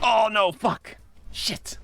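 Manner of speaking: alarmed shout. Real, not acted. Hit hard in the fight.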